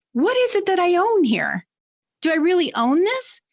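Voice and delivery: high-pitched wondering to oneself; puzzled questioning imitated. Employee walking away unsure of ownership, high puzzled questioning.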